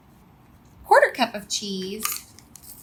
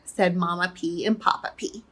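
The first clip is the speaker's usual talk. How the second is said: ordinary delivery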